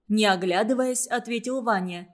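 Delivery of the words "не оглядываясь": usual speaking voice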